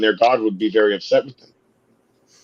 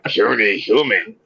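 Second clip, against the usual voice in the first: slow, lower caveman robot dinosaur; 4 semitones lower than usual. Pretend. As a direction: rumble it like a brutish Dinobot. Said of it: "Me Dinobots".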